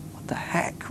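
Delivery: hushed, voiceless exclamation; incredulous whisper under the breath; whispered disbelief. Acted. Disciples' stunned private reaction.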